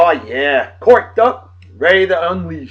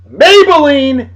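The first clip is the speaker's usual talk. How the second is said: announcer style; 6 semitones higher, 4 dB louder, slower than usual. Acted. Announcing the song title.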